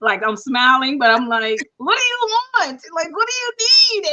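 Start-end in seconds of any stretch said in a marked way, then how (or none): 1.8-4.1 high strained voice through clenched teeth; tight, pitched-up words through the teeth; through gritted teeth. Retold or imitated. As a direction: hiss it through a forced smile. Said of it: "talk through my teeth".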